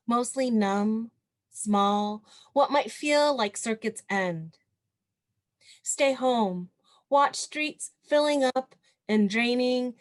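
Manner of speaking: measured poem recital, pausing between phrases; poetry reading. Acted. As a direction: lift and project each phrase, then let it settle. Reads second poem to audience.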